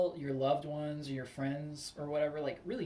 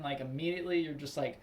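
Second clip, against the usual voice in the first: normal talking voice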